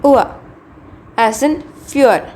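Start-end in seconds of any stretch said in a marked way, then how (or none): 0.0-0.4 "ua" said loud and high as oo-uh; diphthong demo. Shown as a demonstration. Teacher calling out the diphthong to the class, loud and clear. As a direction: glide from oo into uh, loudly. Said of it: "The next sound is".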